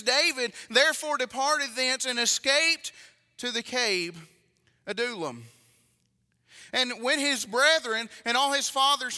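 loud, emphatic pulpit reading; scripture reading. Acted. Preacher reads Bible story to congregation, forceful scripture reading. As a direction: read it out strong, stretching key words. Reads next chapter aloud.

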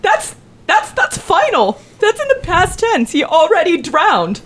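high, strained mock panic. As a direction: pitch it up as fake alarm. Panicked parent improv.

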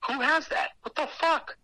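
high-pitched, baffled outburst; incredulous. Retold or imitated. Storyteller reacting to the little stage in the house, incredulous.

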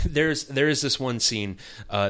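ordinary delivery

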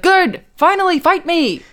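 high, loud, drawn-out challenge; acted-out loud dare; goading. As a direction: egg them on in mock fight talk. Sherlock goading Joan.

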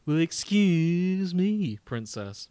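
slow, loud, hammed-up nineties cartoon Link; cartoon prince voice. Shown as a demonstration. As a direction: drawl it like a cheesy cartoon hero. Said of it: "I'm gonna do him with the ... voice".

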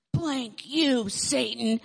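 put-on a touch of a child's defiant yell; mock yell. Retelling the little girl yelling at the puppet devil, mock yell. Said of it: "she yells".

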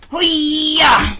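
loud high hoo-yah battle cry; karate yell. Real, not acted. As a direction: shout it like a martial arts kick. Opening can, then asks to cut it.

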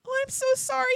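high-pitched remorseful apology; apologetic. Retold or imitated. Husband who ate the treats apologizing to wife, remorseful. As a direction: imitate a guilt-stricken apology, pitched high. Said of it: "he felt so bad".

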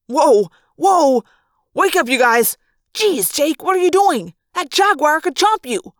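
loud, high alarmed yelling; startled shout. Acted. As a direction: jolt awake and shout in alarm. Startled awake beside jaguar.

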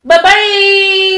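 high, loud, singsong bye; singsong goodbye. Real, not acted. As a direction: sing the goodbye brightly. Singsong sign-off.

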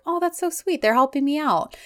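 high, sweet cooing; touched gushing. Retold or imitated. Wife reacting to her husband's help at the store, touched gushing.

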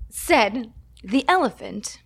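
said the elephant, slow and drawn out in a bad French accent; mock French accent. Shown as a demonstration. Struggling with a French accent for the podcast. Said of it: "I can't do a French accent".

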